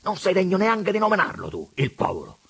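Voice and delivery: loud indignant scolding; angry Pigna. A narrator's reading. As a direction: put him in his place, outraged.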